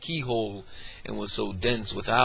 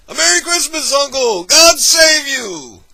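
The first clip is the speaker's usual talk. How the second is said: much higher and louder, bright greeting bursting in; cheerful cry; 12 dB louder than usual. Acted. Nephew bursting in to greet his uncle, cheerful cry. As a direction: call out a joyful Christmas greeting. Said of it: "cried a cheerful voice".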